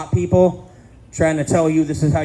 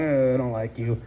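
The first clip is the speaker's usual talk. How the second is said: a hint of a cranky old man, lower and softer; low, softer old-man grumble; grumpy old man; 6 semitones lower, 5 dB quieter than usual. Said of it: "Like, I'm eighty-five when I'm thirty".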